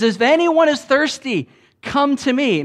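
calling out loud and high; loud proclamation. Retold or imitated. Jesus calling out to the festival crowd, loud and high. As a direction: proclaim it to the whole crowd, full voice. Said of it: "he stands up in a loud voice".

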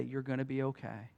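softly retold gentle reassurance; quiet voice. A hushed voice comforting the beaten man, soft. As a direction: let it drop soft and hushed. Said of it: "this really quiet voice say".

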